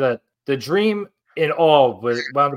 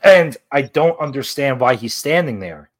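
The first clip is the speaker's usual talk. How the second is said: usual speaking voice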